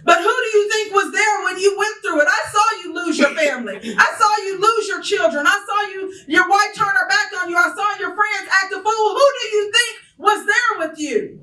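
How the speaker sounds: high, loud preached rebuke from God; preaching God. Acted. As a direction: thunder it down like God answering Job. God answers Job, preaching.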